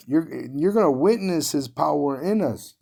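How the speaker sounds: ordinary talk, nothing marked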